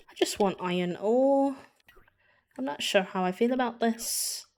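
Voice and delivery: put-on a light, rusty British accent. Mining while viewers request British accent, lightly British. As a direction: play a put-upon British little guy. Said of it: "I haven't done a British accent in a moment".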